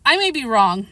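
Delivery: ordinary delivery, nothing marked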